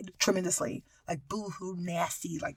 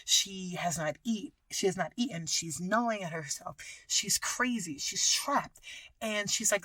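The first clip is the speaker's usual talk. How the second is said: ordinary delivery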